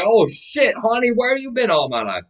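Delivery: high-pitched, delighted exclamation; thrilled mock reaction. Telling the bank owner she's interested, excited mock gushing. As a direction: gush at a dream catch, voice shooting up.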